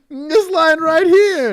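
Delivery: put-on high, slow, loud drunk whine; drunk guy voice. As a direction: play the proud drunk driver. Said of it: "The dude straight up pulled the bottle".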